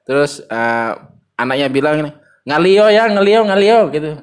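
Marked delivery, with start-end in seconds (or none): 2.4-3.8 louder, higher imitation of a sulking kid; higher, louder retold child; sulky child. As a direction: mimic the child's whiny protest. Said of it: "anaknya itu kayak ngambek".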